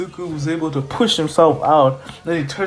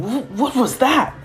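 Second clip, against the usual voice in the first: stunned disbelief; 9 semitones higher than usual. Acted. Boy stunned by blast.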